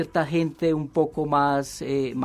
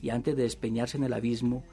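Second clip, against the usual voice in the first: measured novel reading, softer than the chat; story narration; 5 dB quieter, faster than usual. A narrator's reading. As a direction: read the confession aloud as a storyteller. Reads novel excerpt aloud.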